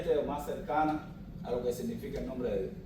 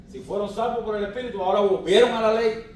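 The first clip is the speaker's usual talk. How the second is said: raised, louder, rebuking line; indignant; 5 semitones higher, 6 dB louder, faster than usual. Acted. Pastor scolding Christians who returned to the law, loud rebuke. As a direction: push it up in mock outrage.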